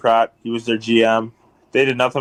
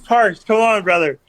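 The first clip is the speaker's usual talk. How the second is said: a hint of coaxing, much higher; mock pleading; 8 semitones higher than usual. Narrator coaxing the rival enforcer, a higher mock plea. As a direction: coax him like a buddy, pitched up.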